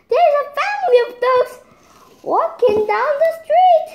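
high, loud and fast alarm; excited report. Acted. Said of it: "and said".

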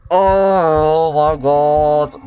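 very slow, drawn-out "oh my god"; long groaned exclamation of shock; shaken recovery. Real, not acted. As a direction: let the fright out in one long stretched phrase. Recovering from the jump scare.

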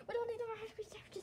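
quick breathy whisper; fast whisper. Acted. Friend grumbles about extra food, fast whisper. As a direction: hiss it quickly under the breath.

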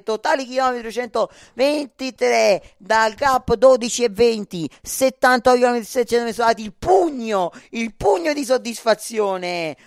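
loud, high, celebrating; excited commentary. Real, not acted. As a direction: cheer the fist bump. Celebrating riders' fist bump.

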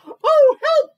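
very loud, high cry for help; startled little tiger shouting high; frightened cry. A narrator's reading. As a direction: yelp out in alarm. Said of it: "cried little Tiger".

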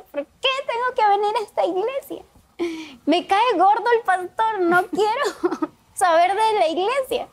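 high, hushed tearful complaint; a crying girl's bitter protest; tearful angry teen. Retold or imitated. Teen girl sobbing out her anger at church. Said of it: "estaba llorando junto a unas escaleras porque estaba enojada".

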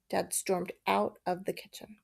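ordinary delivery

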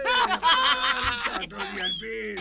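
slow, loud, laughing out the words; laughing hard at his own joke. Real, not acted. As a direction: crack up on every word.

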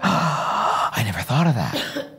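slow, awed gasp then soft wonder; amazed gasp. Retold or imitated. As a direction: breathe it out in amazement. Delighted customer reaction.

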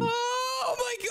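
high frightened cry; scared shriek. Real, not acted. Kid chased by dad in game.

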